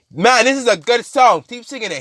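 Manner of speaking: high-pitched, hammed-up delight; gleeful villain. Carnage beating the singer, gleeful.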